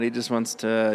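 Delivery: usual speaking voice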